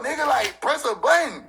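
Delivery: ordinary talk, nothing marked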